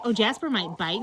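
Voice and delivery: ordinary delivery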